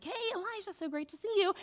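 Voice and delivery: high-pitched, chirpy hello; delighted greeting. Acted. Rabbi greeting Elijah in heaven, high and delighted. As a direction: greet an old friend in a lifted voice.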